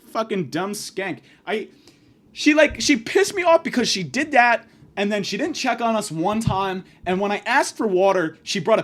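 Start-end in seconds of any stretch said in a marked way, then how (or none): 2.3-4.7 angry rant. Real, not acted. Ranting about waitress.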